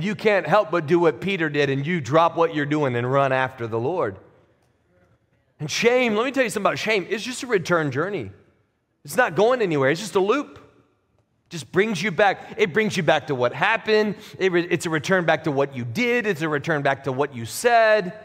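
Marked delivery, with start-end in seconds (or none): 5.6-13.0 high, ringing proclamation of faith; fiery preaching. Acted. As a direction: preach it out to the whole room. Preaching on shame, raised pitch.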